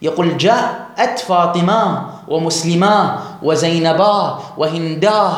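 slow, مسلمة and زينبا ending in h instead of t; drawn-out demo of the dialect ha stop; ha endings, unhurried; h endings for plurals, slow. Shown as a demonstration. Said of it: "لم يقل فاطمات".

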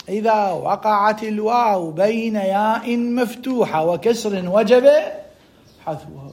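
slow, stretched grammar recital; rule recital. Shown as a demonstration. Teacher citing the morphology rule, slow recital. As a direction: recite slowly, dropping off on the last word. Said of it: "للقاعدة الصرفية القائلة".

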